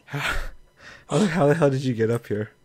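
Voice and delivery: loud, high disbelieving yell; shocked outburst. Real, not acted. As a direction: shout it in shock. Shocked at friend behind her.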